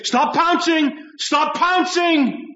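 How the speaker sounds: high, drawn-out mock command; mock scolding. Preacher mimicking what we always want to say, mock scolding. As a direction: pitch it way up and stretch the repeat.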